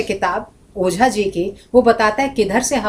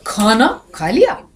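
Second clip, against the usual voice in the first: slow, stretched "खाना", rising hard on "लिया"; drawn-out questioning "खाना खा लिया"; meaning by intonation; slower than usual. Shown as a demonstration. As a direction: draw out "खाना", then question "लिया" upward. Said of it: "देखो कितने अर्थ हो गए".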